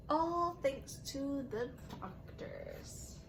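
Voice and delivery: storybook read-aloud with voiced quotes; gentle picture-book narration; reading to children. A narrator's reading. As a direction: read it like story time on the rug. Teacher reads picture book aloud.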